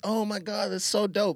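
excited friend. Retold or imitated. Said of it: "She's like".

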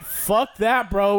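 imitating the friends. Friends refusing to jump.

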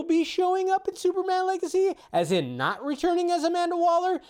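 very high-pitched, baffled squeak; theatrically puzzled, way up high; mock incredulous. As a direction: climb into falsetto disbelief.

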